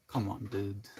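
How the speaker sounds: soft, weary little protest; a quiet, half-hearted plea; muted, mild exasperation; mildly exasperated. Acted. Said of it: "that's the feeling".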